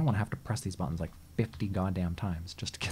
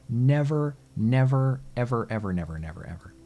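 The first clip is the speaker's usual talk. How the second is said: emphatic vow; 2 semitones higher, 7 dB louder than usual. Real, not acted. Vowing never again.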